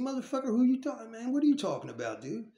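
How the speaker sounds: loud, fast snap at the stranger; angry retort. Retold or imitated. Inmate snapping at a stranger in the next cell, angry. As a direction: bark it back, offended and fast.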